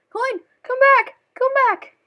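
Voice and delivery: high, loud shout at a runaway coin; frantic shout. Real, not acted. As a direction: beg the coin back, loud. Chasing a coin.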